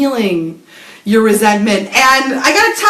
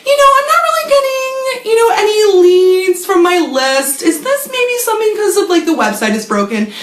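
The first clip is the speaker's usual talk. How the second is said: high, plaintive mock phone voice; mock worried client; 8 semitones higher than usual. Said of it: "I'm gonna call up R K A".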